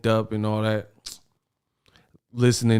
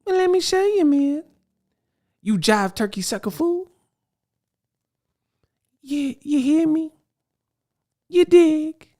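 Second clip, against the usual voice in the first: much higher put-on jive-talking street hustler voice; seventies blaxploitation hustler. Host mocking the boxer's slang as a blaxploitation film, high hammed-up hustler voice. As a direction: ham up a seventies movie pimp, high and cocky. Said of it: "they talk like this, like blaxploitation films from the seventies".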